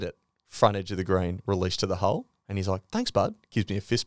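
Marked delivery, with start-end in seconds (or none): 2.9-3.3 high, bright mock-pro thanks, lightly voiced; a quick, pitched-up thank-you from a pro golfer; chuffed thanks. Pro golfer thanks his playing partner after a good shot, voiced high and pleased.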